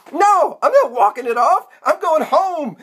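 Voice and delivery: high-pitched, indignant insistence; insistent refusal. Acted. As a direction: lay it down point by point, pitch up high. Refuses, announces going home.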